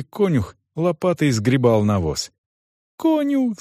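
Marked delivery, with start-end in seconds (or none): 3.0-3.6 put-on high, urgent girl's wail; wailing plea. A narrator's reading. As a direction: call out in high-pitched distress. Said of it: "запричитала девочка".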